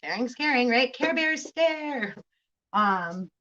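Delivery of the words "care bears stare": silly pun voice. Pretend. Playful singsong pun.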